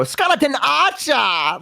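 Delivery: high, drawn-out villain cry; necromancer voice. Acted. Streamer showing a bit done with chat, high slow necromancer cry. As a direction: summon your minion with long theatrical vowels. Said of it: "we have this bit where you go".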